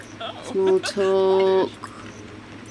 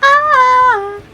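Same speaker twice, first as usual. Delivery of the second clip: Sims gibberish; 9 semitones higher, much slower than usual. Pretend.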